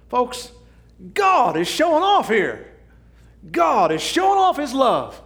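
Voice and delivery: high, loud preaching shout; preaching at full force. Real, not acted. Preacher shouting to the congregation about God's love. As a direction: proclaim it to the whole church.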